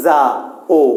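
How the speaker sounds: যা and ও said apart with a pause between; split vowels. Shown as a demonstration. Teacher showing students what not to say, split vowels. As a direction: break it into two sounds, যা, then ও. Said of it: "বলতে পারবো না".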